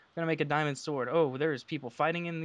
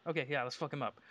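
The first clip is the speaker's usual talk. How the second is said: ordinary talk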